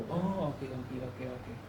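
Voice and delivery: very soft, trailing murmur to oneself; quiet realization. Retold or imitated. Speaker understanding the man's ethnic background, hushed realization. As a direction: let it sink in, low and hushed.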